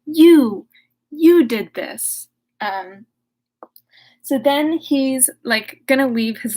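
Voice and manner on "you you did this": loud, slow, pointed accusation; accusing creature. Acted. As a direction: jab each word at him.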